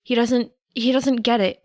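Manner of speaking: plain reading voice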